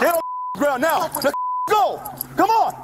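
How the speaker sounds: very high, urgent yelled orders; shouted surrender demands. Real, not acted. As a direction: scream the order at him. Officer yells commands in scuffle.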